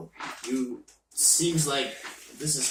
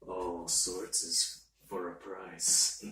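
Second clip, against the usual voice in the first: mysterious entity voice; 7 semitones lower, 7 dB quieter, slower than usual. Acted. Entity speaks low, slow.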